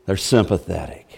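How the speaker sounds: "They're sympathetic" repeated low and soft; soft ironic echo. Shown as a demonstration. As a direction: drop the voice for a wry echo. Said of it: "repeated line, echo softer".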